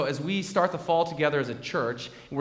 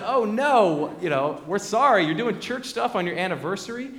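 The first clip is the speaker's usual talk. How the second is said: higher, louder dismay; sympathetic surprise; 6 semitones higher, 5 dB louder than usual. Retold or imitated. Elders reacting to her anniversary news, surprised and louder. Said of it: "And we all said".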